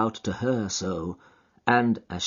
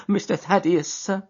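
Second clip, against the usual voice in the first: put-on high, delighted old servant; a high old woman's happy welcome; old housekeeper; 10 semitones higher than usual. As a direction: greet the young master with joy. Housekeeper ends greeting.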